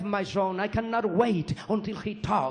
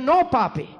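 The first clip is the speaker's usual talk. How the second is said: put-on high, loud, stubborn toddler voice; a small boy refusing to be corrected; child insisting; 7 semitones higher, 5 dB louder, slower than usual. Son correcting his papi about the truck, high loud child voice.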